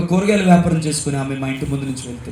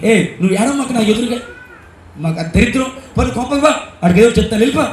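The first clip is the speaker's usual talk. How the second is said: higher, sharp mock scold of a mother-in-law; scornful scolding; 7 semitones higher than usual. Grandmother scolding the daughter-in-law, a hint of angry scorn. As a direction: drive her out of the house with contempt.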